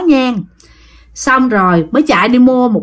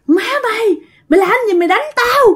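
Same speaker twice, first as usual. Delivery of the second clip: high-pitched acted cry of protest; imitating agonised cursing, pitched far up; cursing in pain; 10 semitones higher, faster than usual. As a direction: curse and beg as if being whipped. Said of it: "vừa chửi mà chửi trong đau đớn".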